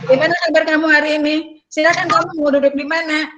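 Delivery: hurried, high-pitched counselor greeting; rushed. Shown as a demonstration. Trainer mimics a counselor racing through a scripted greeting, rushed. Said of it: "terburu-buru".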